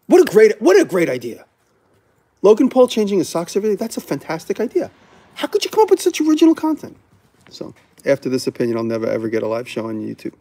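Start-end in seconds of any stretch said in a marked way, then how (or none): 0.1-7.0 high-pitched sarcastic gushing; hammed-up fake amazement; mock fawning executives. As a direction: gush like a sycophant, climbing ever higher. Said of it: "they would be like".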